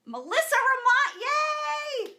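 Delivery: high and loud, delighted yay; excited cheer. Real, not acted. Host cheering loudly as a friend joins the live. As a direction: cheer her name as she pops in.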